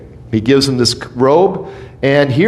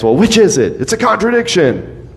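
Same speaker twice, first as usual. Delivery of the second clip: mock critic; 7 semitones higher than usual. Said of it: "some people like to make a big deal".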